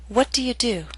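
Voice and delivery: "What do you do?" falling in pitch at the end; falling intonation. Shown as a demonstration. Teacher modelling an information question, falling intonation. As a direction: start high on "What", let "do" fall. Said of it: "use falling intonation".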